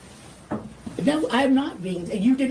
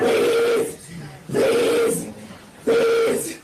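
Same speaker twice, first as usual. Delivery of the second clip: much higher, strained begging; desperate plea; 11 semitones higher, much slower than usual. Real, not acted. Attorney pinned on the floor begging the officers, desperate. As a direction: beg with nothing left.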